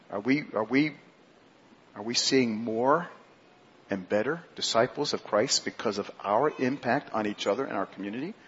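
ordinary talk

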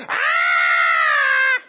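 piercing high prehistoric bird screech; pterodactyl shriek. Retold or imitated.

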